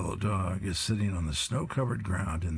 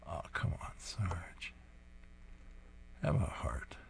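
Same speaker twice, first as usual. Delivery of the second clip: soft breathy whisper, almost unvoiced; hushed aside to the comic; 9 dB quieter than usual. Real, not acted. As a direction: murmur it to yourself. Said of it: "speaker whispers aside".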